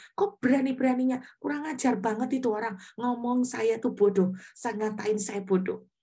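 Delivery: offended ranting, lightly acted; retold indignant complaint. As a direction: imitate yourself venting to God in a huff. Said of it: "saya emosi".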